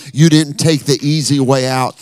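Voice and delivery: building, forceful preacher's delivery; heartfelt pulpit proclamation; impassioned preaching. Real, not acted. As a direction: preach it with rising force to thank the men.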